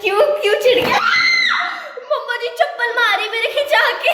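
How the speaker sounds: high, loud laughing yell at her brother; giggling yell across the house; laughing shout. Real, not acted. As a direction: shout and laugh while dodging slippers. Laughing shouts in sibling fight.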